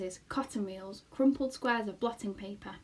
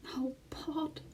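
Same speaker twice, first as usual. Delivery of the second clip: soft pleading whisper; husky whisper; 6 semitones higher, 6 dB quieter than usual. Acted. Homily begging her husband, husky whisper. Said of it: "in a husky whisper".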